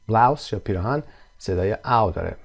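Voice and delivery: ordinary talk, nothing marked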